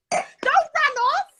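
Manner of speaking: high slow laughing call; laughing call to Judy. Real, not acted. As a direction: plead her not to go. Calling after Judy.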